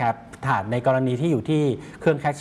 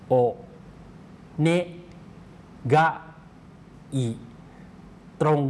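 "โอ เน กะ อิ" one syllable at a time, long pauses between; slow syllable-by-syllable; 2 semitones higher than usual. Shown as a demonstration. Teacher leads viewers in pronouncing together, slow syllable-by-syllable "โอ เน กะ อิ". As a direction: sound out "โอ เน กะ อิ" slowly, each syllable separate. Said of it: "ออกเสียงช้าๆด้วยกันนะครับ".

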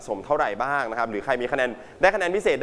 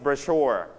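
bro-SHURE, stress on the second syllable. Shown as a demonstration. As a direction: say brochure with the weight on its last syllable. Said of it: "Second syllable, so you pronounce it".

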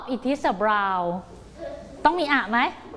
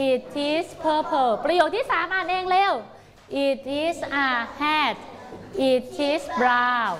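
slow, clear reading with the class; slow model reading; much slower than usual. Shown as a demonstration. Teacher reading along with pupils, slow and clear. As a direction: drag each word for the kids.

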